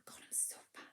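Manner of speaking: breathless whispered moan of "I'm so fat"; mock self-pity. Friend fishing for compliments, whispered mock moan. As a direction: whisper it like a sad little confession. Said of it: "somebody's go".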